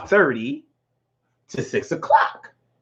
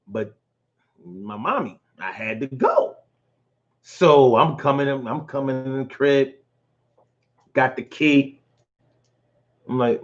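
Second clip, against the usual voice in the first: ordinary delivery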